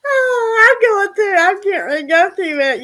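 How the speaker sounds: high, loud laughter through words; talking through a laughing fit. Real, not acted. Laughing hard at comment.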